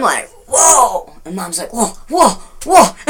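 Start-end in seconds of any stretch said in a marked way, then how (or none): 0.5-1.1 higher-pitched astonished exclamation; startled whoa. Retold or imitated. Telling how the meter spiked, startled. 1.7-3.0 rising, mock-startled whoas; imitated alarm. Daughter imitating mom at the meter, mock-alarmed.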